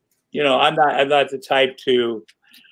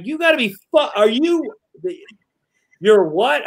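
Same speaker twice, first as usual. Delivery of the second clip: high, stunned outrage; shocked disbelief; 7 semitones higher than usual. Retold or imitated. Boss reacting to the news in sputtering high disbelief. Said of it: "I'm like".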